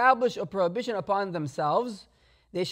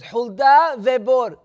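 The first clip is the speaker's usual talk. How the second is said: proclaiming loudly; 9 dB louder, slower than usual. Retold or imitated. She names her two witnesses.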